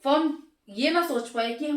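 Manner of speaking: ordinary delivery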